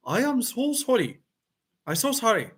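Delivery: high, swooping Canadian accent apology. Shown as a demonstration. Streamer giving another example of their accent, mock Canadian. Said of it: "Now, another one".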